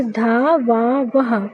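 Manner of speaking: धा वा वह said very slowly, each syllable apart, ending in "ha"; धा वा वह, slow and spaced, final "ha" sounded. Shown as a demonstration. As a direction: spell out धा वा वह slowly, one syllable at a time. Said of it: "विसर्ग को हा उच्चारित करते हैं".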